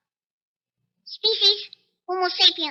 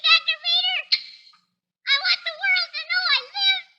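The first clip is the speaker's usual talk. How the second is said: higher, fast, frantic begging; desperate pleading; 8 semitones higher than usual. Acted. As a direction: beg for your name to survive. Dying man's desperate plea.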